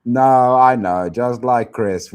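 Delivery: normal talking voice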